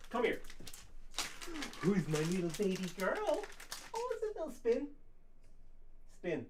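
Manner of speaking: high, cooing pet voice. Real, not acted.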